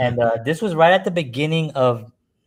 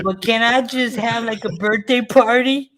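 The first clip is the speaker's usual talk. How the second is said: much higher, slow innocent ask; naive squeaky voice; 12 semitones higher, 5 dB louder than usual. Retold or imitated. Asking the mob restaurant for a birthday party, high naive squeak. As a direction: play the clueless innocent, pitched way up.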